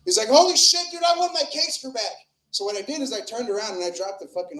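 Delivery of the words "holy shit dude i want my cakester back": high-pitched cry, loud at the start; mock kid outburst, starting loud; outraged kid. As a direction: demand it like a wronged kid. Acts out kid demanding his snack back.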